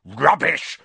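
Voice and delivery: furious one-word dismissal; snarl. A narrator's reading. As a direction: snarl it in contempt. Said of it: "snarled Filch".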